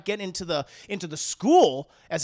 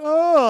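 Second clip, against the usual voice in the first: mock yelp; 9 semitones higher, 12 dB louder than usual. Player falling, exclaimed.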